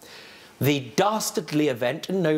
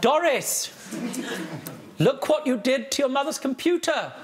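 high, laughing mock telling-off; laughing scolding; 9 semitones higher than usual. Real, not acted. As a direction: scold the parrot through laughter. Judge scolds parrot while laughing.

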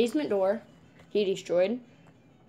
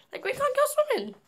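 high-pitched excited outburst; excited squeal. Real, not acted. Sudden squeal.

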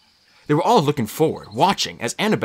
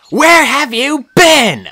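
put-on high, loud, slow girl's demand; a worried friend bursting in; high, loud demanding cry; Annabeth cutting in; 14 semitones higher, 11 dB louder, much slower than usual. A narrator's reading. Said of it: "Annabeth interrupted".

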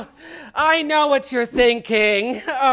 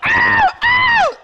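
shrill, much higher "ow, ow" yelped out; mock cries of pain; 5 dB louder than usual. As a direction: yelp as if hurt, hammed-up. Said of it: "Wow, has someone been shot?".

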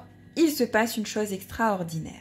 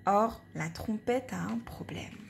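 whisper; 8 dB quieter than usual. A narrator's reading. Narrator confides a secret.

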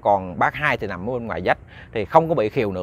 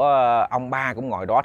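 ordinary delivery, nothing marked

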